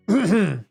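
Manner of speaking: wolf clears his throat; throat clearing. Acted. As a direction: clear the throat before a put-on voice. Wolf readies his soft voice.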